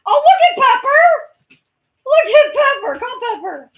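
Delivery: very high, loud excited calling; excited pet voice. Real, not acted. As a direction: squeal at the dog with glee.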